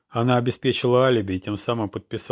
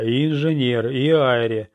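blurted out; 3 semitones higher, 4 dB louder, slower than usual. A narrator's reading. Narrator challenging the commissioner's plan, blurting it out. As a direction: pile on each name as an objection. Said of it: "выпалил я".

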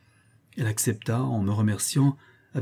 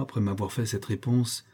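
plain reading voice

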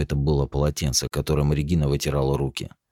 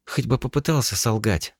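bitter scoff; 8 semitones higher than usual. Acted. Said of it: "горько усмехнулась Регина".

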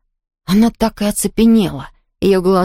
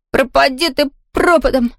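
high-pitched nervous defiance; trembling cry; 7 semitones higher than usual. A narrator's reading. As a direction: curse in a raised, frightened voice. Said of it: "крикнул дрожащим голосом".

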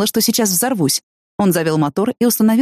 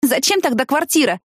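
pitched up, daring and pert; a bold, challenging ask; cheeky question; 8 semitones higher than usual. Acted. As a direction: toss the question back boldly. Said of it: "дерзко спросила она".

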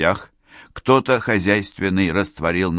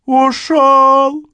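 loud, very high wail; piercingly high, desperate howl; anguished scream; 8 dB louder than usual. A narrator's reading. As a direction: cry out in horror, stretching the word. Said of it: "Вопль пасечника".

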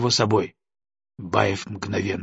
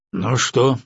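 холодно. A narrator's reading. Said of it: "холодно проговорил Баев".

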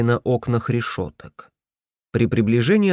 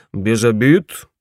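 cheerful bass; 5 dB louder, slower than usual. A narrator's reading. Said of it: "бодро прогудел охранник".

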